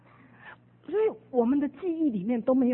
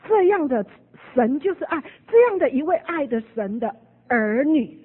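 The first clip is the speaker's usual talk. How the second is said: louder, higher proclamation; fervent preaching; 4 semitones higher, 8 dB louder than usual. Acted. Preacher declaring God's love to the congregation, fervent. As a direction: proclaim it with fervor.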